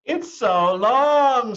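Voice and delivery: high, loud, very slow mocking child; teasing classmate. Classmate Joe teasing Chrysanthemum, high, loud and drawn out. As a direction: stretch it out like a kid making fun. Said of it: "said Joe".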